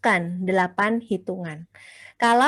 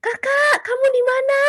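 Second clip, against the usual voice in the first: put-on high shrill-free calling yell to a child, much higher; mock yell; 3 dB louder than usual. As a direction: call out for the missing kid in a mock panic. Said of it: "responnya langsung teriak".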